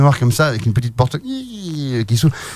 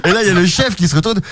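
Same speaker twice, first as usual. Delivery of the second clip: ordinary delivery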